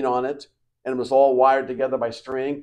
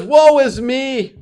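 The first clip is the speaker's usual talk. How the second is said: slow, loud wail; drawn-out lament; anguished cry; 11 semitones higher, 8 dB louder, slower than usual. Acted. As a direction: cry out in despair. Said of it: "He says".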